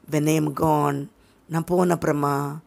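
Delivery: soft, low deathbed voice; dying whisper. Acted. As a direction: fade out like a dying man. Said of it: "ஒரு சாகுற ஆள் என்ன சொல்லுவாங்களோ". (சாகும் ஆளின் மெல்லிய தாழ்ந்த குரல்)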